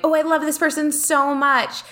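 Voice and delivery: high-pitched retold gush; mock-adoring, pitched well up. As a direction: imitate your own starry-eyed early enthusiasm. Retelling her early enthusiasm for teacher.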